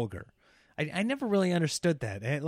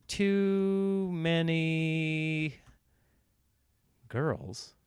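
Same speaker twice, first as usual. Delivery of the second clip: drawn-out musing; much slower than usual. Real, not acted. Slow drawn-out thinking aloud.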